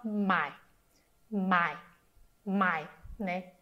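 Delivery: "mai" said low and dipping, Mandarin third tone. Shown as a demonstration. Teacher showing listeners the low third tone on "mai". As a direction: drop "mai" low into a dip, then let it rise slightly. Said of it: "terceiro tom em mandarim".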